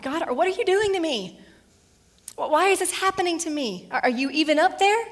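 hammed-up cry to heaven; anguished cry. Job crying out to God after losing everything, loud plea. Job's anguished prayer voiced.

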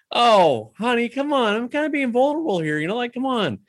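mock-wounded plea to his wife; whiny pleading. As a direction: beg her to take it seriously.

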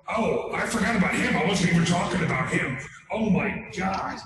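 rambling wrestler-politician impression; exasperated mock candidate; Jesse Ventura. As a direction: keep up the promo voice, getting sidetracked. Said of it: "of Jesse Ventura".

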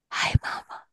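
soft imitated child whisper; whispered toddler voice. Toddler to drifting-off mom in bed, whispered. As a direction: whisper it like a little one.

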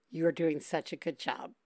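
soft, low reassurance. Real, not acted. Host softly reassuring a crying caller. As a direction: gently tell her she's fine.

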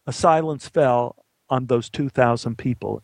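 usual speaking voice

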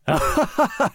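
big booming belly laugh. Acted. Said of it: "How about a belly laugh?".

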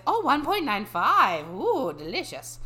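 hammed-up covetous creature, pitched high; Gollum. Mocking bio readers impressed by the height, hammed-up Gollum voice. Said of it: "Like Gollum with the ring".

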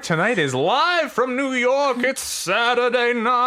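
slow, high, drawn-out announcer call; mock SNL announcer. Cohost opening the sketch show as a hammed-up announcer.

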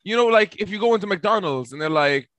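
normal talking voice

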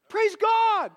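retold cry of joy on the phone; joyful outburst. Rejoicing at conversion.

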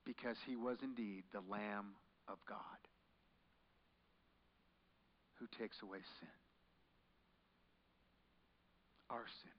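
soft, reverent near-whisper; hushed and solemn. Real, not acted. Preacher reflecting on the Lamb of God, hushed reverence. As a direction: drop to a hush of awe.

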